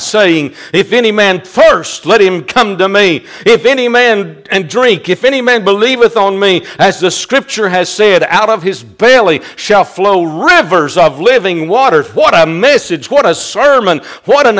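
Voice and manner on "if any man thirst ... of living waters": ordinary delivery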